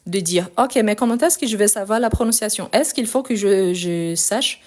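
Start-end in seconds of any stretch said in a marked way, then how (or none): none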